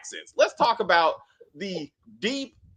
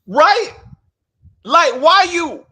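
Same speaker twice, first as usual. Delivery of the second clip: much higher and louder, slower; indignant yelp of agreement; outraged; 11 semitones higher, 10 dB louder, slower than usual. Real, not acted. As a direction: cry out in disbelief.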